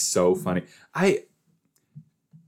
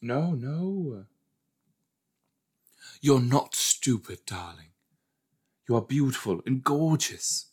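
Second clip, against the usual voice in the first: put-on darling voice, high and drawn out; mock doting reassurance; 4 semitones higher than usual. Puts on voice to comfort.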